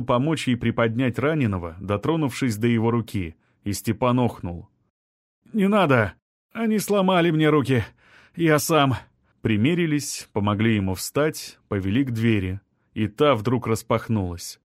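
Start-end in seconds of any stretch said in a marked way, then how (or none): 5.5-8.9 pained cry. A narrator's reading. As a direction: cry out in pain, pulling away. Injured man cries out in pain.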